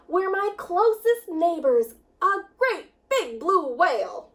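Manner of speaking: plain reading voice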